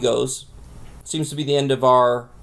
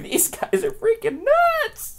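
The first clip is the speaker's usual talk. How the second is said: high squeaky disbelief. Retold or imitated. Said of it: "I'm like".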